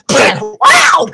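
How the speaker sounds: sudden high imitated fight noise; punch impact cry. She lands blows as he misses, punch impact cry. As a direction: yell out a high mock impact sound. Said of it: "and she's like".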